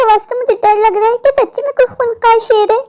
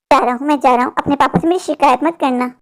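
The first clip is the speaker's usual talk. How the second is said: lower, fast coaxing voice of a shady man; sly stranger; 6 semitones lower, 3 dB quieter than usual. Acted. Stranger coaxing a little girl to come along, sly and lower. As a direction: sweet-talk the child like a creep.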